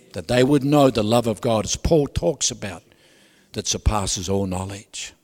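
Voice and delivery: usual speaking voice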